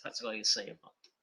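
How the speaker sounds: a hushed throwaway aside; whispered resigned shrug. Real, not acted. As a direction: whisper it under your breath.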